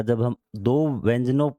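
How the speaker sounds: ordinary talk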